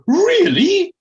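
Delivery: high, loud, drawn-out posh query; Felicity Forsyth Twike, sheepish. Acted. Posh lady feigning surprise at the story, drawn-out. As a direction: stretch it out slowly in affected surprise. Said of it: "replied slowly and somehow sheepishly".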